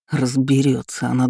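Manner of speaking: ordinary delivery, nothing marked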